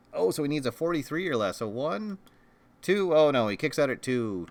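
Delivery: rising excitement on the count; wrestling commentator. Acted. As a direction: call the pin live, building up. Live count during pin.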